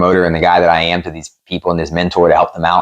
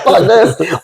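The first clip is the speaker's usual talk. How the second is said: laughing, much higher mock outburst; laughing mock anger; 10 semitones higher than usual. Fighter recalling throwing his gear, laughing mock anger. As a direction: laugh through a fake tantrum.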